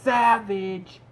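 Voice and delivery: loud, high excited cry of savage; excited exclaim. Retold or imitated. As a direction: hype it up like a fan in awe. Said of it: "And I was like".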